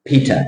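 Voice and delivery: Peter with puffed P and T, stress on PEE; Peter, aspirated and front-weighted; correct English Peter. Shown as a demonstration. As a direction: hit PEE in Peter, short falling -ter. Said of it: "อืม อืม no".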